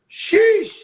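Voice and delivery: high, loud admiring sheesh; whistle-like awe at the pictures. Real, not acted. Admiring Remy's photos.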